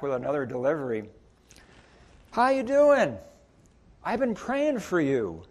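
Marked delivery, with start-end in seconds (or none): 2.3-5.4 much higher, bright friendly hello; lightly voiced eager greeter; cheerful greeting. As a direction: greet an old acquaintance warmly. Greeting customer again.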